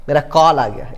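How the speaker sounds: "कॉल" said with an open aw; in-between vowel. Shown as a demonstration. Teacher correcting to the borrowed vowel, "कॉल" with aw. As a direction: sound "कॉल" between kaal and kol. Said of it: "बोलते क्या है".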